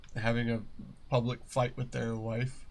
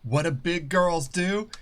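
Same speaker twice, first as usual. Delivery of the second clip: louder, higher firm mom; stern mom; 6 semitones higher, 6 dB louder than usual. Retold or imitated. Mom telling her fallen girl to stop crying, firm and loud. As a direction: command the child to toughen up. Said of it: "and goes".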